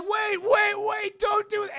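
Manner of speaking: high, loud, urgent protest; mock panicked plea. Storyteller voicing onlookers as mallet comes, panicked mock pleading. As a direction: beg them to stop, imitating panicked onlookers.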